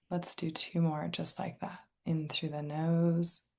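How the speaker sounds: calm, unhurried meditation guide, long pauses; guided meditation. Real, not acted. Inviting listeners to tune into senses and breath, guided meditation. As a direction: softly guide listeners into relaxation.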